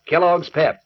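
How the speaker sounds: ordinary talk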